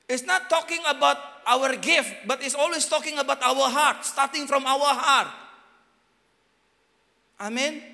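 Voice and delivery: loud, high, slowed proclamation; full-force preaching. Acted. Preacher to congregation, a loud high-pitched proclamation. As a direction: preach it out to the whole church.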